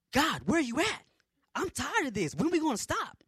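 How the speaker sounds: high, loud exasperated plea; fed up, raise your voice in complaint; frustrated arguing. Retold or imitated. Tired marcher arguing with God, loudly exasperated. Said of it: "arguing back and forth with him".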